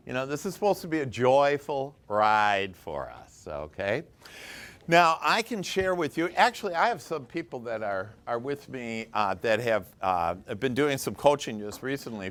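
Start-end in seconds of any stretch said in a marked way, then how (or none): none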